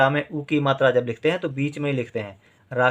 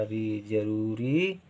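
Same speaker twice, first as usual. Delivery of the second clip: री then जरूरी said slowly and softly; slow softer recap of जरूरी; slow dictation; 3 semitones lower, 6 dB quieter, much slower than usual. Shown as a demonstration. Teacher to pupil, slow recap of जरूरी. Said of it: "रा के साथ ई का उच्चारण".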